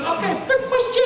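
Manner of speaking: put-on very high Chinese-accented examiner, mispronouncing "third"; hammed-up excited Chinese accent; thick Chinese accent. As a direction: announce it in a squeaky Chinese accent. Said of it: "she had a really thick Chinese accent".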